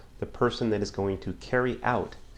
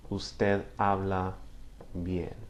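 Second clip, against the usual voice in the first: "Usted habla bien" said slowly and carefully; teacher modelling the formal "Usted habla bien"; slow, polite model sentence; 3 dB quieter, much slower than usual. Shown as a demonstration. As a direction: lay out "Usted habla bien" gently for learners. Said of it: "if I'm being more formal, more polite".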